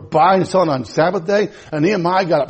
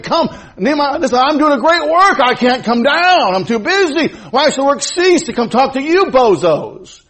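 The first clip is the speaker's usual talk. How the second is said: higher, brushing them off, too busy; Nehemiah refusing; 9 semitones higher, 4 dB louder than usual. Acted. Nehemiah tells his enemies he won't come down, impatient. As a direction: wave off the bozos impatiently.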